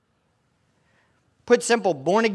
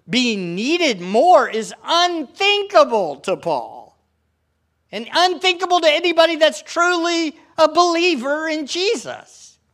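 rising fervour; 9 semitones higher, 4 dB louder than usual. Real, not acted. Preacher building to high point.

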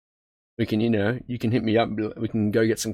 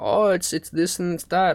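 mild mock critics; 8 semitones higher than usual. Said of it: "people are talking about".

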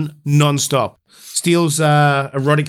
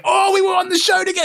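excited shout; faster than usual. Retold or imitated. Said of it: "They're like".

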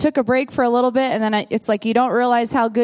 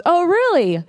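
higher, eager friend voice; lightly voiced friend, pitched up; imitating Melissa; 7 semitones higher than usual. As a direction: lift it up like the excited friend. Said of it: "And she goes".

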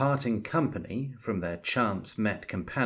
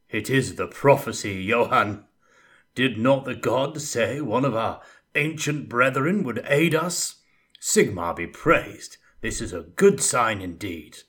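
booming knight; 3 semitones higher, 7 dB louder than usual. Acted. Armoured knight proclaims the prophecy.

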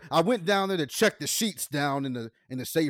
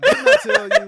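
squeaky high scolding voice, much louder and higher; mock Ivanka scolding; 13 dB louder than usual. Mocking Ivanka scolding Trump in a shrill, loud voice. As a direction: shriek it like a furious daughter.

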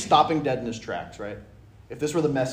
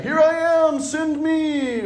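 much higher, eager cry; eager volunteer; 7 dB louder, much slower than usual. Acted. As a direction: answer the call with zeal. Eager answer to God's call.

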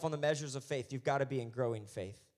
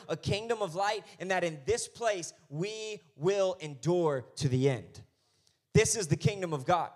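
loud, high, soaring preacher's cry; the closing rise of a revival sermon, loud and high; full-force preaching; 5 semitones higher, 6 dB louder than usual. Acted. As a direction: build it up and proclaim to the church.

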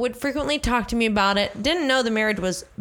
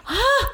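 embarrassed shriek. Real, not acted. Embarrassed outburst.

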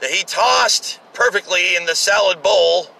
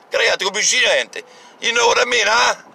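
put-on Italian mob boss accent; 6 semitones higher than usual. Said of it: "like the Italian mob boss going".

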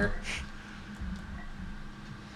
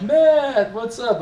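much higher, louder chipper hello; mock cheerful greeting; 10 semitones higher, 5 dB louder, much slower than usual. Coworkers greeting Matt at work, cheerful mock call. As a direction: call out brightly to a friend.

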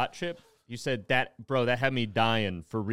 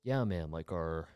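low, quiet attempt at a Joe Rogan voice; a hint of Joe Rogan, barely done; Joe Rogan impression; 6 semitones lower, 6 dB quieter, much slower than usual. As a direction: mumble a half-hearted gruff impersonation. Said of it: "I wanna hear you do it".

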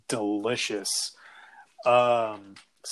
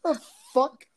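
shocked disbelief; 11 semitones higher than usual. Retold or imitated. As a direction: react like you can't believe your ears. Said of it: "I'm just like".

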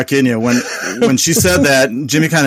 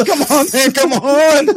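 frantic urging; 10 semitones higher, slower than usual. Retold or imitated. Frantically pressing elevator button.

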